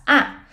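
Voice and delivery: short, loud "a" falling from high; descending tone. Shown as a demonstration. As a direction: say "a" a little angrily, dropping downwards. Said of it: "sound a little angry".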